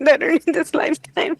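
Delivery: high, loud, quick through laughter; laughing with emotion. Real, not acted. Client tells hypnotist it is her daughter, laughing with emotion. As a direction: burst it out, overcome with real laughter.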